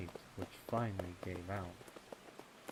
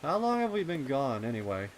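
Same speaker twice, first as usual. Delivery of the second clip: put-on young colt voice; 6 semitones higher, 12 dB louder, faster than usual. Voices Snails.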